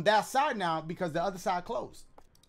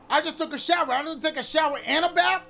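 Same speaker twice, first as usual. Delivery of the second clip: higher, louder indignant protest; loud complaining; 7 semitones higher, 7 dB louder than usual. Real, not acted. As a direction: protest loudly, incredulous. Complaining at game character.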